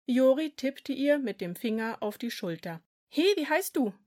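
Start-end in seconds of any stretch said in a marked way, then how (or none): 3.1-3.9 put-on higher child voice; curious kid asking; boy voice. A narrator's reading. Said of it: "fragte er".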